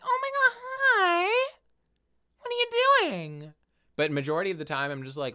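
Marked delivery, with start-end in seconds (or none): 0.0-3.5 squeaky-high, drawn-out gushing to a cat; exaggerated baby-talk pet greeting, slow and high; high-pitched pet voice. Shown as a demonstration. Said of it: "like, a high-pitched, like, offset voice".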